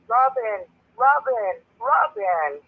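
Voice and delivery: imitating a boy yelling a name from afar; retold yelling. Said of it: "screaming and yelling".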